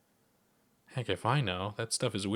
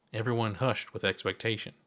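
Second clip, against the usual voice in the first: ordinary delivery, nothing marked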